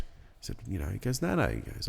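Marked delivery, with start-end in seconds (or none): none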